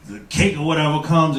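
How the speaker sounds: ordinary delivery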